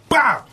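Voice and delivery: sharp shouted mock punch noise; punch sound effect. Telling the hosts about a fight, a shouted punch noise. Retold punch, loud exclamation.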